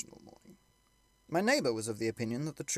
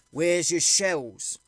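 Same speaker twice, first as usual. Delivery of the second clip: loud, slow, higher challenge; scornful retort; 6 semitones higher, 9 dB louder, much slower than usual. Acted. First soldier scoffing at the shelling idea, loud and slow. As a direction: fire the question back at him.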